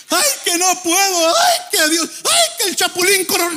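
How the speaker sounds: high, whimpering mock complaint; mock whining. Preacher mocking a whining believer, high mock whine. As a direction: whine like a spoiled child.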